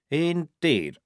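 normal talking voice

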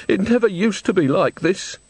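high tearful lament; sobbing. A narrator's reading. Old woman grieves over how things changed, sobbing. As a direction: lament it through acted tears. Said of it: "sobbed Missus Plinge".

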